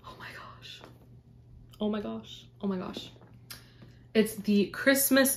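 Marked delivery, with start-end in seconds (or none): 0.1-0.8 barely audible, stunned whisper; awed whisper. Real, not acted. Overwhelmed by a puzzle gift, awed whisper. 1.8-3.1 very soft, stunned murmur; hushed awe. Real, not acted. Taking in a puzzle gift, hushed awe.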